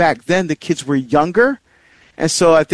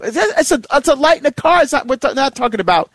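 high-pitched, stumbling protest; incredulous at the triviality; exasperated; 9 semitones higher, faster than usual. Real, not acted. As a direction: protest how petty it all is, voice pitched up. Exasperated at making light an issue.

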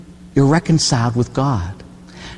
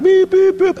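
high-pitched beeping horn noise; imitated truck horn; 3 dB louder, slower than usual. Big truck bearing down on people crossing, high imitated honking.

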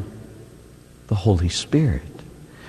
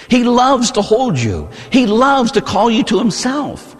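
impassioned plea; 10 semitones higher than usual. Real, not acted. Preacher's passionate appeal.

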